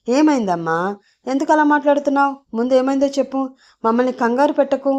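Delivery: father on the phone, worried, urging his daughter; anxious father. A narrator's reading. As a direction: hurry her to explain.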